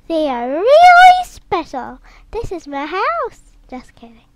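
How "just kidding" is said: softly whispered "just kidding"; whispered aside. Real, not acted. To viewers, taking back the joke in a whisper. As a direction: breathe it as a secret joke.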